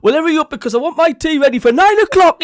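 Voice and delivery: loud, high impatient demand; Big Davey. Retold or imitated. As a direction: holler it like a bossy husband. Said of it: "Davey's saying something like".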